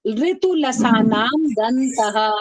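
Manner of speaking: slow, drawn-out sutra chant; slow recitation. Shown as a demonstration. Teacher chants the sutra slowly for the class. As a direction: stretch each syllable. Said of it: "अब आगे पढ़ते हैं".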